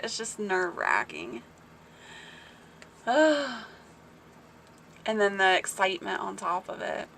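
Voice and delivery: ordinary delivery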